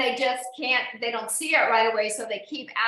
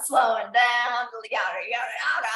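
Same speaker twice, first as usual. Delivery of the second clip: Gilda Radner voice; 2 semitones lower than usual. Retold or imitated. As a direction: ham up a famous comedian's bit. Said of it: "she had that kind of, that accent".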